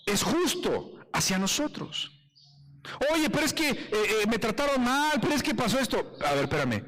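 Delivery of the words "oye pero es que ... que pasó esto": higher-pitched mock complaint; protesting objector. Preacher mimics someone protesting bad treatment, complaining. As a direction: plead your case, pitched up.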